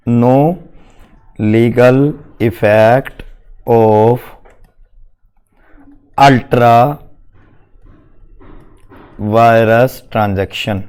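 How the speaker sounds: low, very slow note-taking dictation. Real, not acted. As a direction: spell it out word by word for writing. Dictating notes slowly to class.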